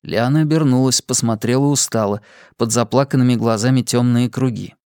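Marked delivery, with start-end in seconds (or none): none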